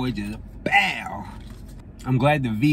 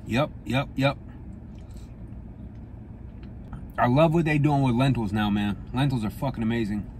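speaking between spoonfuls; talking while eating chili; mouth full, chewing. Real, not acted.

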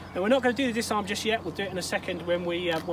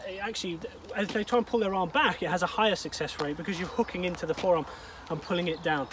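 ordinary talk

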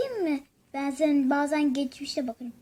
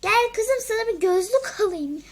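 calling out; 7 semitones higher, 6 dB louder than usual. Acted. Mother calling daughter over.

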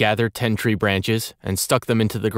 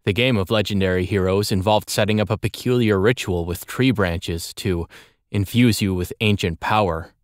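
ordinary delivery, nothing marked

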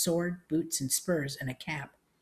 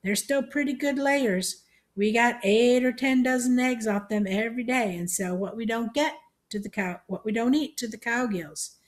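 put-on high, raised old-lady voice; elderly country aunt, pitched up; old Aunt May; 7 semitones higher, 4 dB louder than usual. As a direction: chat loudly like a hard-of-hearing old woman.

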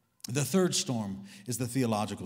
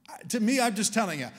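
usual speaking voice

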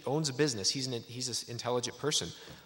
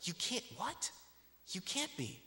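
much higher, softer mock gasp of disbelief; hammed-up stunned stranger; shocked disbelief; 9 semitones higher, 8 dB quieter, slower than usual. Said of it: "he gasps and he's like".

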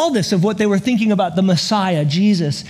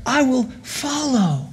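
put-on much higher, eager kid's voice praying, slowing at the end; childlike joyful prayer; 8 semitones higher than usual. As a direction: pray like the whooping kid on the bike. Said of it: "may we be just".

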